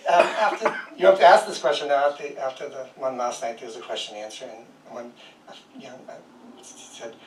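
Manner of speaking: ordinary talk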